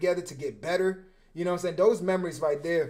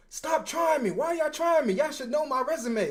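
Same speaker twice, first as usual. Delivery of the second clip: high mock yell, taunting the offense; retold yelling at receivers; 9 semitones higher than usual. Defensive back taunting the opposing sideline, high yell. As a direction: call it out across the field, cocky. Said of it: "I kept yelling to their side".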